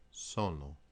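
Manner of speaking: sono repeated, SO-no; single n starts the syllable; Italian syllables. Shown as a demonstration. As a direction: model sono split so-no. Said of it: "single consonant in between two vowels".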